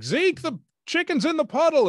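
high, loud call across the yard; Southern family member calling to Zeke. Retold or imitated. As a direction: holler for Zeke. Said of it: "I said".

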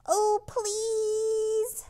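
put-on high, pleading kids' voice; pleading children. A narrator's reading. Clara and Jack begging their teacher, high pleading. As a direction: beg sweetly like little children. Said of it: "they pleaded".

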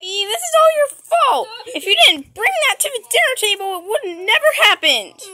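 loud, high, fast accusation; angry blame. Acted. Sibling blaming the kid for the blackout, angry and loud. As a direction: snap at them for ruining dinner.

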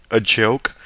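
ordinary delivery, nothing marked

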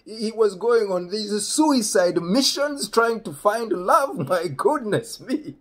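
high, loud incredulity breaking into laughter; imitating amused dad. Dad marvelling at his son's risky romance, rising into imitated laughter. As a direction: build up slowly and crack up.

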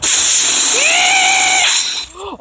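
very high shouted gasp; big shocked gasp. Real, not acted. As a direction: gasp in horror. Shocked after hitting someone.

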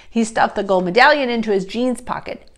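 usual speaking voice

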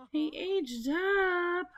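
high, drawn-out exclamation; astonished, elongated announcement; dismayed surprise. Real, not acted. Reacting to Dad turned elder.

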